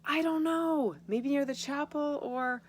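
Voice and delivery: high, loud and slow call; calling back. Acted. Mother calling back to Edith across the dock, loud high call. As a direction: shout the answer back over the noise. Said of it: "Lucia's mother called back".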